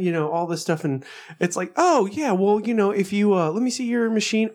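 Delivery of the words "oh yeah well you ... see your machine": high, louder eager offer; eager helper. Retold or imitated. IT tech offering help, eager. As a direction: jump in eagerly.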